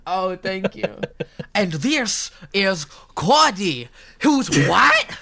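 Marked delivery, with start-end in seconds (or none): 1.5-5.2 slow, then jumping high and loud; put-on announcer-like voice. Host introducing Cody in the voice bit, exaggerated and rising. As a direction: start drawn out, end on a loud high what.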